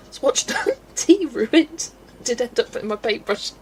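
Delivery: ordinary delivery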